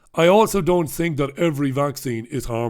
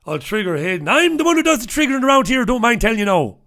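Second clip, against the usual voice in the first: high, fast, loud put-on brogue; quick high brogue, bragging; Hayden's accent; 7 semitones higher, 3 dB louder, faster than usual. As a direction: mimic Hayden's accent with a swagger. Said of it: "That's what he says in, in that accent".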